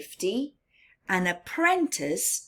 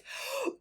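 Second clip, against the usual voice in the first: gasp; 11 dB quieter than usual. Shown as a demonstration. Reader demonstrates holding breath.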